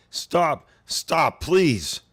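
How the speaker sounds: high, loud, strained plea to stop; begging monster. Acted. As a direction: beg for your life, cornered and hurt. Wounded apostle begs for mercy.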